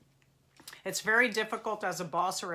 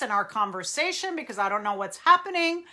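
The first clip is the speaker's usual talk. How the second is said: loud, raised pitch with swooping emphasis; sudden intense voice; 2 semitones higher, 6 dB louder than usual. Shown as a demonstration. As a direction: burst out as if losing control in conversation. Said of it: "Gina is talking to you like this".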